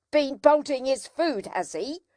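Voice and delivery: crying out. A narrator's reading. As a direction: cry it out in alarm. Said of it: "cried my sister".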